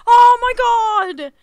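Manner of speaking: high, loud, slow wail; real anguished outburst; dismayed cry. Real, not acted. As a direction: cry out in drawn-out disbelief. Realising what went wrong.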